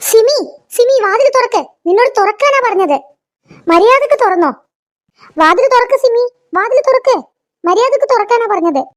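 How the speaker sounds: bang on the door and yell at her; angry shouting. Acted. Mother-in-law shouting at Simi's locked door, angry yell.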